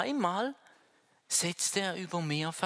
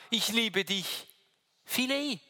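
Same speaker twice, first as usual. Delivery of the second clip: voicing Peter; 5 semitones higher than usual. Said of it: "Er sagt".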